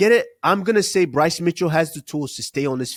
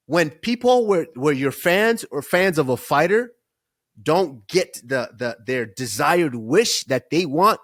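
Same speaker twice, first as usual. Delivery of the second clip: normal talking voice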